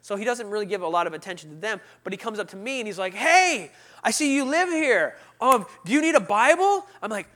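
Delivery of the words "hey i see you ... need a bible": high, loud, chirpy offer; hammed-up church greeter; overfriendly greeter. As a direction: pester with eager helpfulness. Greeter approaches him.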